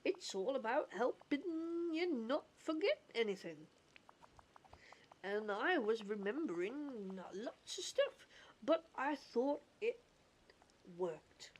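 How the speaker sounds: goofy cartoon voice with stretched words. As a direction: ramble along as a hesitant cartoon character. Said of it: "goofy accent".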